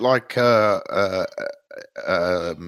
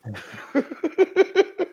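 shrieking laugh. Real, not acted. Laughing after the growl.

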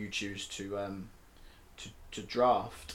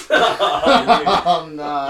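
loud, high burst of laughter; laughing it off; 4 semitones higher, 13 dB louder than usual. Real, not acted. Cracking up at team name.

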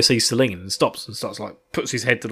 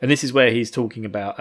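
ordinary talk

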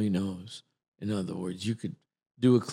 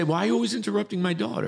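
higher, quick mock scolding; scolding mother; 9 semitones higher, much faster than usual. Host voices guest's mother defending her daughter, mock scolding. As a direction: imitate an indignant mother chiding someone. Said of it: "No, she'd say".

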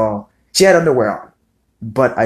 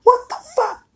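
shrieking high-pitched scream; mock startled scream; retold scream. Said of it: "and she screamed".

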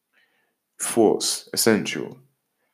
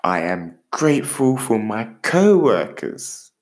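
slow, measured, gently paced affirmations; slow affirmation to repeat; slower than usual. Shown as a demonstration. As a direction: lead the listener through each line slowly. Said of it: "Repeat after me".

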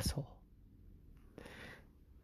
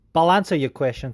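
normal talking voice

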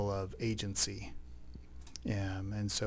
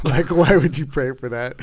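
laughing disbelief; 4 semitones higher, 10 dB louder than usual. Retold or imitated. Wife's look mocking their prayer.

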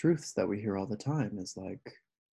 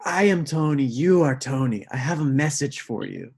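mock solemn declaration; 4 semitones higher, 7 dB louder than usual. Quoting the stranger's proclamation.

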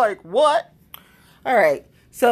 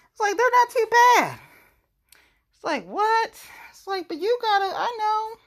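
high mock voice. Mocks balance board high voice.

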